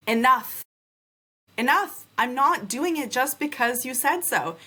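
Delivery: retold outburst.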